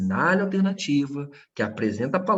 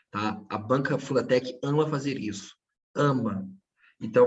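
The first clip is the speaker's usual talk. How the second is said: normal talking voice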